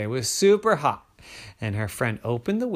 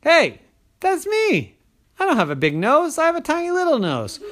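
thrilled at her tiny nose; high gleeful squeak; delighted squirrel; 5 dB louder than usual. Acted. Delighted at reflection.

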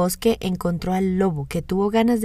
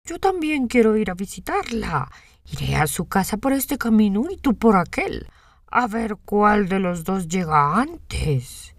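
lobo astuto y taimado; voz de lobo; 2 semitones higher than usual. A narrator's reading. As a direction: lobo que trama algo, lento al final. (voz de lobo astuto)

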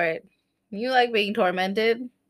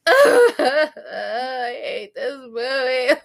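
long, drawn-out comic wail; mock sobbing; much slower than usual. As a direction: bawl it slowly like a hammed-up breakdown. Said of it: "I could watch a commercial and cry".

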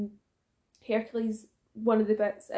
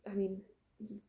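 whisper; 11 dB quieter than usual. Real, not acted. Joking aside about fighting dirty.

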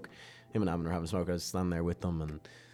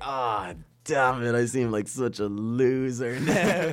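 embarrassed groan; 6 semitones higher, 8 dB louder, much slower than usual. Retold or imitated. Self embarrassed at the mistake.

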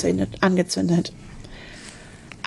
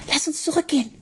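high, hushed urgent plea; hissing; 7 semitones higher, 5 dB quieter, faster than usual. A narrator's reading. Charlotte begging Ben to turn back, hushed hiss. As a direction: plead softly and urgently to leave. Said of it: "zischte Charlotte".

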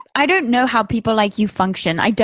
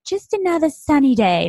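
lilting, higher-pitched fake cheer; mock cheery caption; 5 semitones higher than usual. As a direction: parody a sugary social media caption. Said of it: "captioning it".

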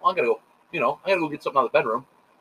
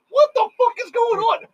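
much higher, startled mock outcry; confused outcry; 3 dB louder than usual. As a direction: imitate the whole room asking at once, pitched way up. Everyone reacts to the commotion.

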